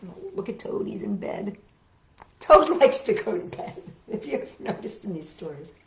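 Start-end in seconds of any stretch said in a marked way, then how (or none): none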